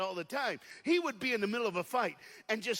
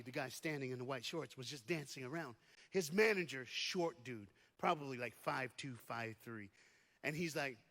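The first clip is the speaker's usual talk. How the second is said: normal talking voice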